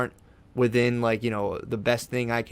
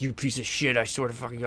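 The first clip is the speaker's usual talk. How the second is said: harsh inner voice; 7 dB quieter than usual. Retold or imitated. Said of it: "that kind of voice".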